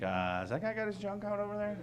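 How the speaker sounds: a soft, puzzled aside as a suspicious deer; deer talking. Pretend. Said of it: "they'd be like".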